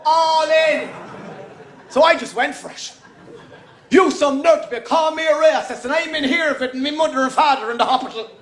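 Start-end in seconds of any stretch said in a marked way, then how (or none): 3.9-8.3 put-on higher, fast rambling Irish Traveller accent. Comedian rants at the lads in a fast Traveller accent. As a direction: gabble on in a Traveller accent, all in. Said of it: "answered them in their accent".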